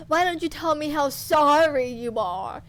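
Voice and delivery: loud sneering demand; mock him as you demand it; cruel taunt. Torturer taunts captive.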